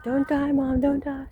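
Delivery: lightly voiced tearful daughter; daughter crying. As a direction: imitate the daughter's tearful plea. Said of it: "Of course, she's crying".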